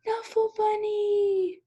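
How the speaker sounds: high, slow, drawn-out cry of delight; joyful discovery. A narrator's reading. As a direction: exclaim it like finding a lost treasure. Toy found in washer.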